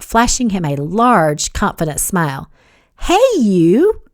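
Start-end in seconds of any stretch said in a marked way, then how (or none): 3.0-4.0 high sing-out greeting; cheerful. A narrator's reading. Kat greeting Wyatt on the couch, cheerful. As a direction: greet flirtatiously, pitched well up. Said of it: "she said cheerfully".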